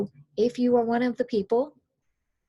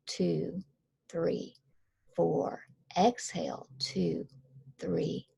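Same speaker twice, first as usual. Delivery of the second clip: put-on soft counting for a child; 2 semitones lower, much slower than usual. Shown as a demonstration. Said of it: "you would basically say".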